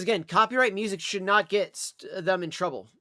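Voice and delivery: ordinary talk, nothing marked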